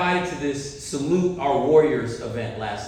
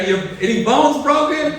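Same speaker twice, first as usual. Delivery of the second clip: higher, louder frantic check on his hurt son; frantic dad; 9 semitones higher, 7 dB louder than usual. Retold or imitated. Dad running down to his injured son, frantic loud question. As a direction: call out urgently in alarm. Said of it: "I said".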